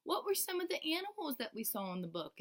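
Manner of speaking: put-on high, bright question to children; teacher quizzing the class about the book, bright and high. Shown as a demonstration. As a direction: ask the class, voice lifted high. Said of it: "I will say".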